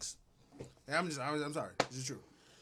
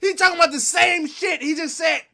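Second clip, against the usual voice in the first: mock rapper boast; 6 semitones higher, 6 dB louder than usual. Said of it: "he like".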